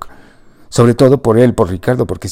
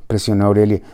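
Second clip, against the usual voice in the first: ordinary delivery, nothing marked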